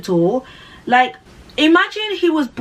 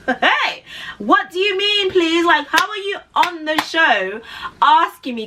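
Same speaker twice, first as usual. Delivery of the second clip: incredulous; 7 semitones higher than usual. Real, not acted. Incredulous reaction to citizenship question.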